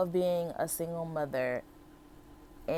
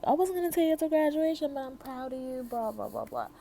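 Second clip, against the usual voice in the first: higher, quicker, gushing aunt voice; imitating other aunt; 8 semitones higher than usual. Other aunt admitting her pride early, higher, quicker imitated voice. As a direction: tumble it out high like an excited aunt. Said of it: "she's all like".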